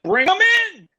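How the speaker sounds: shouted high and loud, thrilled; eager shout of welcome; excited call. Retold or imitated. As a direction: call them in with delight. Excitedly calling a celebrity in.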